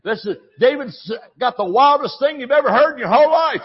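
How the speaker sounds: calling wife in mock awe. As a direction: shout for his wife in mock excitement. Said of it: "he's mocking you the whole time".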